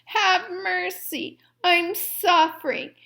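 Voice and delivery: high, slow begging; pleading wail. A narrator's reading. Fox begging the dentist for help. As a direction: stretch out the plea. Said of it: "the fox wailed".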